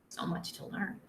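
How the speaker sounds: soft unvoiced whisper; whispered aside. Reader sighing to children about math, whispered. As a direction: breathe it out as a little sigh.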